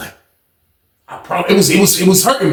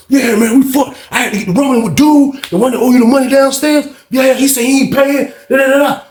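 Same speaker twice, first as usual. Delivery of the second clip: high-pitched, agitated rush of explanation; worked-up retelling; 7 semitones higher than usual. Retold or imitated. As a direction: act out someone riled up explaining. Acting out agitated account of fight.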